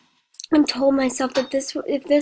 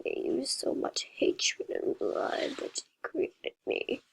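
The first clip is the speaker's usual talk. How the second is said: creepy whisper; 2 semitones higher, 8 dB quieter than usual. A narrator's reading. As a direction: hiss it like a creature. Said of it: "disturbing robot".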